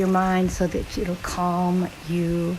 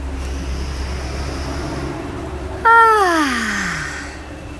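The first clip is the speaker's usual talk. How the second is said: windy "ah" sigh; 4 semitones higher than usual. Shown as a demonstration. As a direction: let a full ah sigh blow through. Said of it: "Another one".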